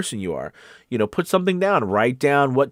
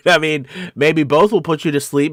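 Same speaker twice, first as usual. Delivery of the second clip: louder, higher, through real laughter; laughing; 6 semitones higher, 7 dB louder than usual. Real, not acted. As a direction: say it laughing at your own joke. Amused at own joke.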